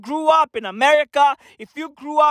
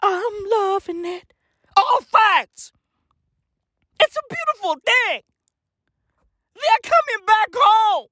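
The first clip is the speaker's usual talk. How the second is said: excited; 7 semitones higher than usual. Real, not acted. Excited shouted wrap-up.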